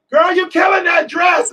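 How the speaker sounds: high, loud holler from the crowd; screaming fan. Retold or imitated. Audience member yells to the singer on stage, screaming. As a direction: scream it out in admiration. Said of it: "somebody's screaming".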